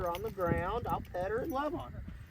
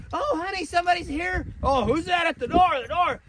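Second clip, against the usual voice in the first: much higher and louder, all worked up; frantic owner; 10 semitones higher, 9 dB louder than usual. Retold or imitated. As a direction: squeal it like a flustered owner. Anxious owner at the door.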